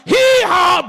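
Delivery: loud, high hee-haw; one big comic bray; donkey bray. Retold or imitated. Preacher braying as the donkey before it speaks.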